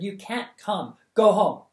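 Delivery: rising to a loud firm order; stern command. Acted. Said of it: "She pointed sternly".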